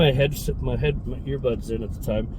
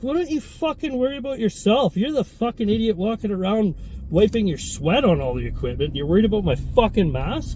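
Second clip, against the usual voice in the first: put-on higher, heated retort at the old man; firing back at the old guy in the gym, angry and higher; retold angry comeback; 7 semitones higher than usual. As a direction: snap back at him, indignant.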